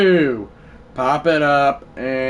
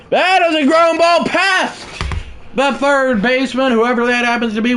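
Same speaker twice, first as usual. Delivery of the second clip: higher, excited broadcaster call; play-by-play call; 9 semitones higher, 4 dB louder than usual. Acted. Gamer narrating his own game as announcer, excited call. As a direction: sell the grounder like a TV announcer.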